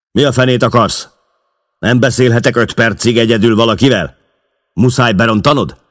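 furious boss yelling at the door; angry outburst. Acted. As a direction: snap at the intruder, hot and fast. Boss barks at intruding barman.

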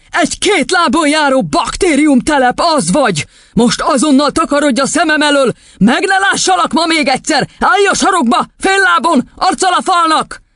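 high, forceful tyrant teacher; shouting headmistress. Acted. Headmistress banishing a boy to the corner, yelling. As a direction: scold and order the child about in a high, strained yell. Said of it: "ordította az igazgatónő".

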